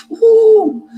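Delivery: loud, high drawn-out "woo"; imitated woo. Testifier imitates people hit by searing heat, high woo. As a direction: let out a high, loud whoop.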